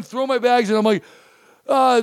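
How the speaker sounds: normal talking voice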